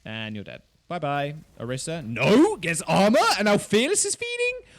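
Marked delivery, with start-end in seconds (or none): 2.1-4.7 loud, high-pitched cry of disbelief; shocked commentary. Real, not acted. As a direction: shout it in rising, incredulous alarm. Commentator reacts to sudden bad play.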